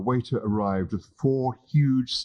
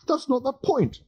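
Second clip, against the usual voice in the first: much higher, indignant objection; high, exasperated protest. Acted. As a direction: push back, pitched way up. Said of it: "Arthur protested".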